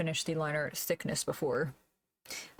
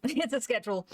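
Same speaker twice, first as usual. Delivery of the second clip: laughing it out, pitched up; 5 semitones higher than usual. Real, not acted. Streamer to chat about the artwork, laughing. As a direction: giggle through the words. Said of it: "Quote unquote artwork".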